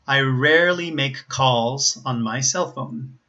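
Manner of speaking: slow, careful model reading; practice sentence. Shown as a demonstration. Teacher gives a sentence to practice, slow model reading. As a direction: read it slowly for learners to copy. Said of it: "sentences that perhaps you could practice".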